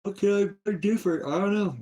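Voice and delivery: high, strained, close to crying; pleading, voice cracking with despair; near tears. Real, not acted. Despairing over his troubles.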